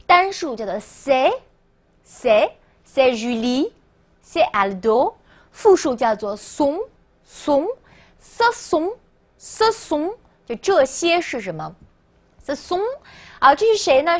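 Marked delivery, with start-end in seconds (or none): 0.8-5.1 spaced-out singular phrases, careful and slow; slow read-along. Shown as a demonstration. Teacher drills the singular with learners, slow model reading. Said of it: "好，跟我读啊". 6.4-10.1 very slow, separated sont and ce sont; slow read-along. Shown as a demonstration. Teacher drills the plural with learners, very slow reading. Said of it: "跟我读啊".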